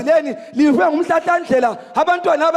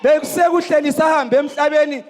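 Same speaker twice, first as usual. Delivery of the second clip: normal talking voice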